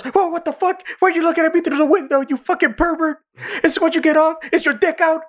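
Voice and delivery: very high, squeaky outrage; mock indignant. Retelling the crying character caught through the window, mock indignant. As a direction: squeal the accusations in comic indignation.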